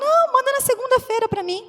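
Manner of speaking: hint of a bright phone recruiter; bank caller's voice. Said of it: "Ela falou".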